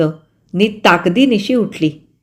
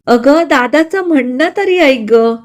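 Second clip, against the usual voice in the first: crying plea; 7 semitones higher, 4 dB louder than usual. A narrator's reading. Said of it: "Surekha रडत सांगत होती".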